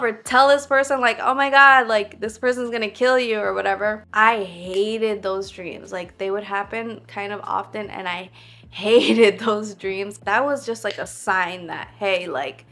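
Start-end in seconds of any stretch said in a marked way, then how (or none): 1.2-3.4 panicked yell to warn someone; urgent dream warning, near-screamed; retold scream. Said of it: "like, I'm screaming". 8.5-10.2 a rueful laugh mid-line; amused groan about bad dreams. Real, not acted. Laughing at her own dreams.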